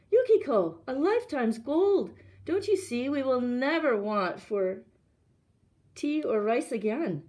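high, loud, insistent persuasion; excited urging. A narrator's reading. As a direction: press her, thrilled by the gold. Husband urges reluctant wife.

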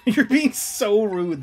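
slow, loud laughing mock scold.

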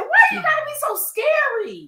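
high, loud laughing protest; startled complaint. Real, not acted. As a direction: squeal it, shaken and amused by the scare. Complaining after a jump scare.

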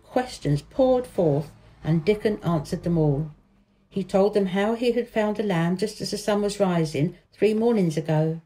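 ordinary delivery

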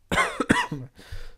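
sharp cough; coughing fit. Real, not acted. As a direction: cough hard into the mic. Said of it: "scusate ancora la tosse".

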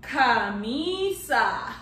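"camisa" stretched slowly, ka-MEE-sa; slow Spanish model. Shown as a demonstration. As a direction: drag out "camisa" for the class. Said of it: "shirt in Spanish is".

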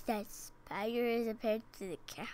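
fast, breathy whisper echo. Real, not acted. Child whispering back the parent's words, hushed.

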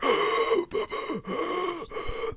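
high sharp gasp for breath; gasp for air. Retold or imitated. Waking from a drowning nightmare, a high gasp for air.

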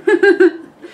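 short loud high laugh. Real, not acted. A vlogger laughing at her funeral memory. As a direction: burst out laughing.

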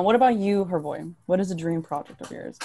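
ordinary delivery

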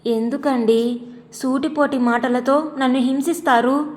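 usual speaking voice

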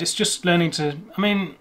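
usual speaking voice

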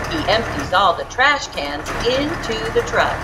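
ordinary delivery

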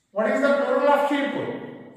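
ordinary talk, nothing marked

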